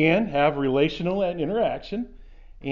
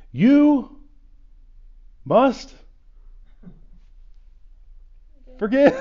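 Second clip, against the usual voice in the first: each word hit far higher and louder, with long pauses; emphatic command; 11 semitones higher, 10 dB louder, much slower than usual. Shown as a demonstration. Pastor driving home the command to forgive, word by word. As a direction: hammer "you", "must", "forgive" one by one. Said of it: "it was an emphatic".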